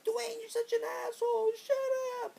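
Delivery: loud, very high wailing imitation; tearful Shauna. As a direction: wail angrily like an upset girl. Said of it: "Shauna's still crying".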